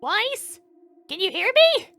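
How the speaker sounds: high, loud call into the dark; calling upward. Acted. Ruby calling up to Weiss from below, high loud call. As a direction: shout up the shaft to a friend far above.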